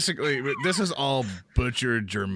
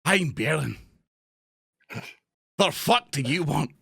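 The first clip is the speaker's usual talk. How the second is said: smug suave dwarf; 4 semitones higher than usual. Acted. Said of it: "like I'm kinda suave".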